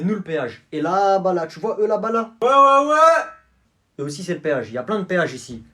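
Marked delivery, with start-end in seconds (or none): 2.4-3.2 high, loud eager agreement; excited. Acted. Toll guys cheering on the racket, high and loud. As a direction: pump it up, yes yes yes.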